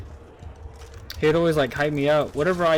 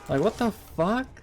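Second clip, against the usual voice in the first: disbelief; 6 semitones higher than usual. Real, not acted. Incredulous rant.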